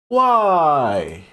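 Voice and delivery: long, falling wail of why; drawn-out dismay. Pretend. Streamer appalled by the shoe design, in drawn-out mock dismay. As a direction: stretch it out as it slides down.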